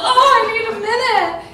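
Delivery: high, loud mock distress; pained overwhelm. Storyteller acting out needing a minute, high mock distress. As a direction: cry out, overwhelmed and half laughing.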